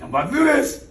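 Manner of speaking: voicing a pumped-up player, high and loud; hyped boast. Coach voicing a pumped player, hyped and loud. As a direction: talk yourself up before the play. Hyped quote in pep talk.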